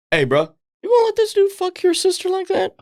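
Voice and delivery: high, fast mock goading; egging him on. Friend needling a guy about his sister, egging him on. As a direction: stir him up with hammed-up disbelief. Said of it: "I egg that shit on".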